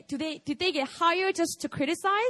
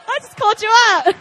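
fast, loud, high, amid laughter; laughing call-out; 8 semitones higher, 13 dB louder, faster than usual. Real, not acted. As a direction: blurt it out loudly between laughs. Teasing a listener amid laughter.

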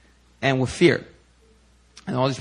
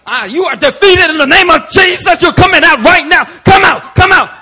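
much higher and louder, shouting commands at the demon; commanding shout; 13 dB louder than usual. Retold or imitated. Pastor Stephen yelling at the demon, fierce and much louder. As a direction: roar it like an old-school deliverance preacher.

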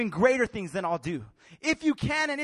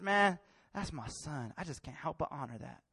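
tender God; 8 semitones lower, 8 dB quieter than usual. Acted. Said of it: "God says".

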